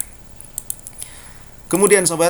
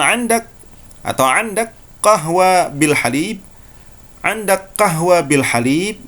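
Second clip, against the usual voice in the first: Saudi Arabic question, a bit higher; Saudi Arabic accent; 6 semitones higher than usual. Shown as a demonstration. Teacher demonstrating the short Saudi way to ask for coffee. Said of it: "Saudi cukup mengatakan".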